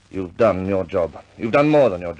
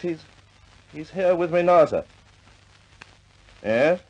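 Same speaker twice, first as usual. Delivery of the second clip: higher, eager yes-sir voice on the phone; deferential phone manner; 6 semitones higher, 3 dB louder than usual. Acted. Commander taking a call from his superior, deferential and higher. Answering phone to Air Marshal.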